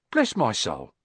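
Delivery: ordinary delivery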